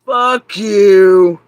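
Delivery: explosive loud curse; angry shout. Real, not acted. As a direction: blast it out at them. Angry outburst at her detractors.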